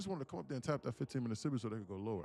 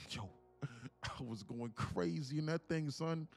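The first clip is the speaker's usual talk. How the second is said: loud, drawn-out New York brag; 5 semitones higher, 9 dB louder, much slower than usual. Acted. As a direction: boast to the homeboys in mock New York. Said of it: "Oh, my New York voice".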